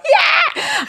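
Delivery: very high celebratory squeal; excited cheer. Retold or imitated. Co-host retelling her reaction to an award, excited squeal. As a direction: squeal it like a first-time winner.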